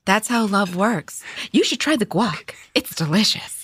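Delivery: high, sugary 1950s housewife gossip; chirpy churchy housewife chatter. Acted.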